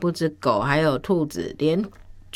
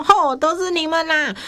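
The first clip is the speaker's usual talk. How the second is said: very angry; 9 semitones higher than usual. Acted. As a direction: act out furious blame, voice pitched way up. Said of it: "阿秋很生气哦". (很生气地大声责怪)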